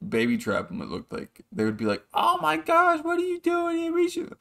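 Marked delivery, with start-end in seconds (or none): 2.1-4.3 very high mock girly falsetto; mocking high-pitched fangirl; fawning girls. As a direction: squeal it high like gushing female admirers. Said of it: "so many girls that would walk over to him".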